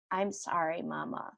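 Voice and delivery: a hushed little sorry to mom; soft child's apology. Retold or imitated. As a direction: say it small and quiet like a sorry little boy. Child apologizing softly, retold.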